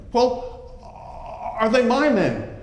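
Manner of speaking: anxious Ulrich. Acted. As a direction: press him for an answer.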